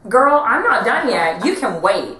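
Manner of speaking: high, loud sassy diva; loud drag queen sass. Retold or imitated. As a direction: snap at her like a drag queen. Drag queen sassing next contestant.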